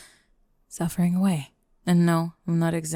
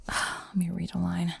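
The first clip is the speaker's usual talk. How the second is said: whisper; 7 dB quieter than usual. Acted. Whispering before reading poem.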